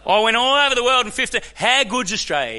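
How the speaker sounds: high, loud shout of celebration; crowd-rousing burst of delight; exuberant cheer. Real, not acted. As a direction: belt it out high, like a proud fan. Comic climax of the food day.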